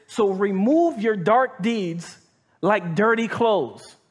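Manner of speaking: each word laid down slowly; slow emphatic reading. Shown as a demonstration. Preacher slows down so the congregation hears it. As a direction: read it slow so it sinks in. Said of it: "Listen to this".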